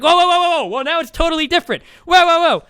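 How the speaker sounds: very high, loud hammed-up whoa whoa whoa; shrieking mock panic, caricaturing a flustered rival; caricaturing the rival's panicked backpedal, high and loud; mocking opponent. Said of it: "And he's like".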